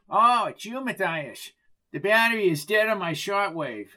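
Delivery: security guard character. Acted. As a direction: put on an officious little guard's voice.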